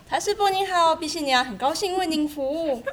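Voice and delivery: bright, high and loud phone greeting; sweet phone voice. Shown as a demonstration. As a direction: answer the phone sweetly and warmly. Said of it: "甜美的声音接电话呢".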